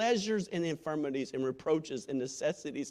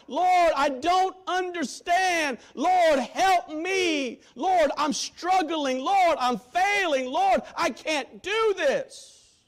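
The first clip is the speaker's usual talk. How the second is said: slow, loud, high pleading to God; anguished prayer shout; pleading cry; 14 semitones higher, 9 dB louder, much slower than usual. Retold or imitated. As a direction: cry out desperately. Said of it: "cried out to God".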